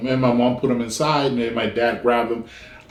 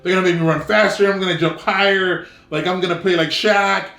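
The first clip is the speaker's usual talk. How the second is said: put-on begging kid; 7 semitones higher than usual. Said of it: "I told my dad everything under the moon".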